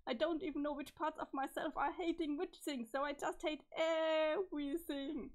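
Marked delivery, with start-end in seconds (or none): 2.9-5.3 slow, tearful "hate everything"; sobbing despair. Acted. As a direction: let the voice break into tears. Character breaks down at the end.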